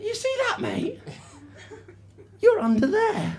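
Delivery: high, soft mock taunt; taunting football fan. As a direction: imitate a pub heckler in a high, hushed voice. Football fan's taunt acted out.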